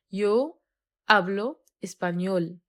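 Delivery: slow, careful teacher model, each syllable clear; slow model sentence. Shown as a demonstration. Teacher to students, slow clear model. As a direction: spell it out slowly for the learners. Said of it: "teacher models Spanish slowly".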